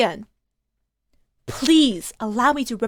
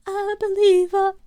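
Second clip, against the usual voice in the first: ordinary talk, nothing marked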